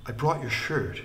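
halting, stumbling start on brought; faltering. A narrator's reading. Wickham handing back the shirt to Lin, halting. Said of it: "he said falteringly".

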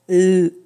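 L said as a held lll; letter sound. Shown as a demonstration. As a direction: sound out "L" as a sustained consonant, lll. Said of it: "pour les prochaines syllabes".

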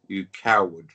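loud jeer. Real, not acted. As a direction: punch out coward loud and low. Taunting opponent, calling him coward.

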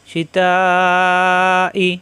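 শিতাই held long on the ই; drawn-out recitation. Shown as a demonstration. Teacher showing the long vowel to students, chanted. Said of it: "এখানে চার আলিফ টানে".

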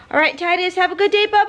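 high, sing-song goodbye to the dog. Real, not acted. Mom saying bye to her dog in sweet high baby talk.

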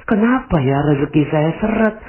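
high, pleading complaint to God; plaintive introspection. Acted. Person introspecting asks God why their provision is stuck, plaintive. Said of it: "seandainya Anda sekarang ini berintrospeksi".

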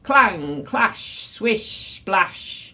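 sound-painted clashes and swishes; battle sound effects. A narrator's reading. Narrator imitates the great sea battle, sound effects. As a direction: make each word its noise.

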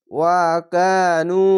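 ওয়াকানু recited slowly, কা and নু each held long; Quranic recitation. Shown as a demonstration. Teacher recites a word slowly for Quran pupils. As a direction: stretch কা and নু in ওয়াকানু. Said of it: "এক আলিফ টেনে পড়বেন".

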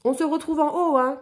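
shouted high and loud up into the trees; calling out. Acted. Alfred calling down to Lou from the tree, loud shout. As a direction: call out to him from the treetop. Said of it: "cria-t-il".

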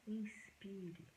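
soft, hushed meditation voice with long pauses; quiet, unhurried relaxation guidance; guided meditation. Acted.

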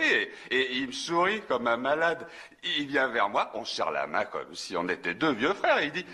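usual speaking voice

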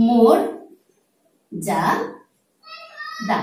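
মোর, যা, দা split with pauses between; syllable by syllable. Shown as a demonstration. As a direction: break মর্যাদা into its syllables slowly. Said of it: "শব্দটি ভেঙে দিচ্ছি". (মর্যাদা ভেঙে ভেঙে ধীরে বলা)

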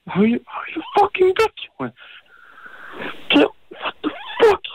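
shouting his friend down in real rage; screaming rage. Real, not acted. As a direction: voice cracking very high in fury. Furious at friend over sister.

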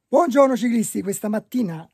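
loud greeting. Real, not acted. Bright loud greeting to viewers.

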